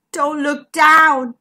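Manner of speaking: slow, loud, drawn-out warning. A narrator's reading. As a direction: warn them slowly and firmly. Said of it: "warned Zigbee".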